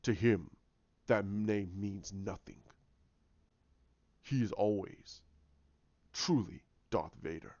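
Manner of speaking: low, slow, solemn villain voice; brooding dark-side conviction; menacing villain. Acted. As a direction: pronounce it darkly, low and deliberate. Villain insists Vader is his true self.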